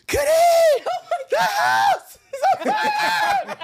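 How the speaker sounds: screaming high and loud in panic; panicked wife shouting down the phone; frantic shout. Retold or imitated. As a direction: yell it like the house is burning.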